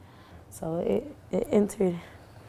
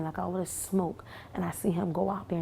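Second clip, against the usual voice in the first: normal talking voice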